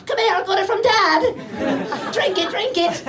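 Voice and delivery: put-on high, slow kid voice; mock a drunk child egging a mate on; drunk twelve-year-old. Drunk English kid sharing stolen booze, hammed-up child voice. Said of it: "drunk twelve-year-olds with some stolen Smirnoff Ice".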